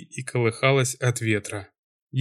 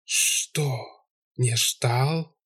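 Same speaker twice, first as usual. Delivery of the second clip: hollow hissing voice; 4 dB quieter, much slower than usual. Acted. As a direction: hiss it softly like a shadow spirit. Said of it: "глухой шипящий голос".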